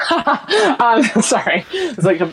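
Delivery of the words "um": sinister laugh. Real, not acted. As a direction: chuckle like a villain. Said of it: "a bit of a sinister laugh there".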